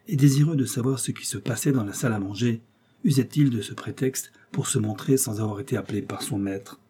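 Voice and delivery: plain reading voice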